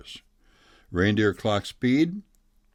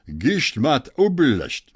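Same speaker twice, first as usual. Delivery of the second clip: mock German gibberish, higher and louder; thick German accent; 6 semitones higher, 6 dB louder than usual. Scientist exclaiming over Blitzen in hammed-up German accent. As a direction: ham up the German scientist's muttering. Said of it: "thick German accent".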